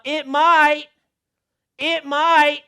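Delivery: high, loud, hopeful whine; mock self-excuse. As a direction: mimic the tempting voice inside. Mocking own inner excuse.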